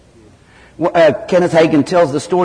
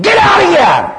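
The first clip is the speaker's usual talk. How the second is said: much higher, loud furious command; exasperated shout; 6 dB louder than usual. Retold or imitated. Hagin shouts at the chattering demon in exasperated fury. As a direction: bark it out and drive the demon off. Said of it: "in his frustration and exasperation".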